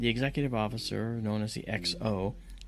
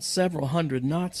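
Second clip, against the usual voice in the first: loud, high, disbelieving report; young sailor answering the officer loudly; loud incredulous answer; 6 semitones higher, 5 dB louder than usual. Retold or imitated. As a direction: blurt it out in disbelief. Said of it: "answered him and said loudly".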